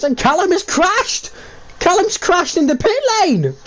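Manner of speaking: high, excited commentary yell; pitch-lane crash, excited high call. Real, not acted.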